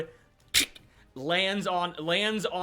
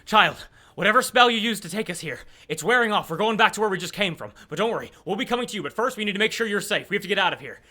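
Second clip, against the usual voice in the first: louder, raised voice in a hurry; urgent; 3 semitones higher, 6 dB louder, faster than usual. Acted. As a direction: rush out a pressing message to a child. NPC urgently reassures child while fleeing.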